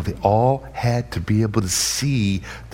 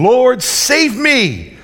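high, slow, loud plea; imitated desperate cry; cry for help; 13 semitones higher, 5 dB louder, much slower than usual. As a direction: call out to be saved. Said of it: "he cried out".

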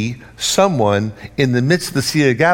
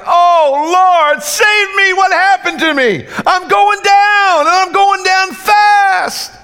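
very high, loud, slow panic; hammed-up drowning panic, shouting; panicked cry; 7 dB louder, slower than usual. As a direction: scream for help as you sink. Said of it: "we're like".

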